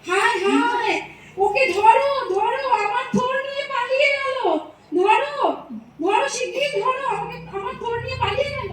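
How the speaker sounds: high, rushed calls to catch the thief; high frantic shouting, almost crying; frantic cry for help. Acted. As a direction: shout in panic as the thief runs off with your goods. Thief runs off with his goods.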